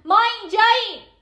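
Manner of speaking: high, loud cry for help; desperate shout. Acted. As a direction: scream it out so someone hears. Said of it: "با هم داد بزنیم". (فریاد بلند و ناامیدانه)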